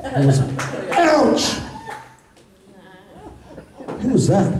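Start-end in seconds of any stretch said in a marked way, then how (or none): 0.9-1.6 high, abrupt cry of pain; sudden high-pitched exclamation; sudden mock ouch. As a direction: yelp it as a trigger word. Interrupts himself with trigger word.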